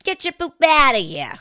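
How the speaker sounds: loud, high mock shout; mock yell. Host shouting at the train of poop, loud and high. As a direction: shoo it away with a yell. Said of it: "I just had to say it".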